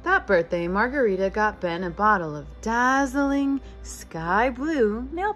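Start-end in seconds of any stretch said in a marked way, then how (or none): none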